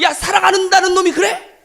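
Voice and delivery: high, loud, indignant outburst; hammed-up angry yell; mock scolding. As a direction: act out an incredulous telling-off. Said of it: "이렇게 혼내셨어요?".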